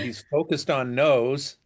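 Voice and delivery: ordinary delivery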